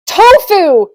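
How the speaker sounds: loud, high exclamation, falling at the end; triumphant. Acted. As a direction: shout it out like a winning answer. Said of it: "he said triumphantly".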